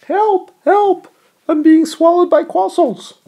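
high squeaky cry for help; panicked little voice, very high and loud; tiny cartoon voice. Mock cry of drowning in croissants.

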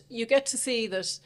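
ordinary talk

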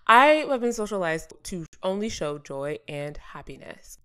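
normal talking voice

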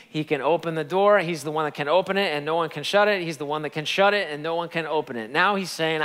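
ordinary delivery

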